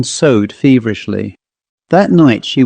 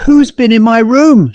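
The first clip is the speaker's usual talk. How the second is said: much higher, louder frightened gasp of a question; gasped in fright; 11 semitones higher, 5 dB louder than usual. A narrator's reading. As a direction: blurt it out, shaken and breathless. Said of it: "she gasped".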